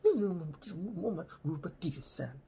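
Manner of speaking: soft, muffled parental muttering; imitating mumbling. Parents conferring about the visiting boy, imitated soft mumbling. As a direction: mumble it under your breath, high then falling. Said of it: "they're mumbling at you".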